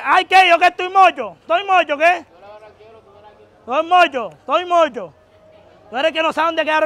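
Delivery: indignant quarrelling yell; angry defiance. Real, not acted. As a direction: shout back defiantly, ready to fight. Squaring up in a street quarrel.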